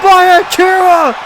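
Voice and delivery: slow, stretched-out high shouting; excited shout. Acted. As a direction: scream the finish, drawn out. Finish of the match.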